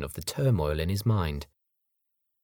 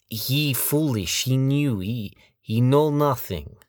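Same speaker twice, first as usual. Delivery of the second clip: put-on slow, higher broken-English accent; foreign accent; 6 semitones higher, 4 dB louder, slower than usual. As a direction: stumble through in a thick accent.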